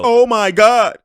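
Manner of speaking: high, loud exclamation; loud disbelief. Real, not acted. Watcher reacting to another foul call, loud disbelief. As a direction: cry out in disbelief.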